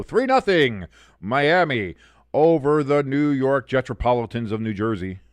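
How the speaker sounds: loud announcer-style score call; score announcement. Real, not acted. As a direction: proclaim the score to the viewers.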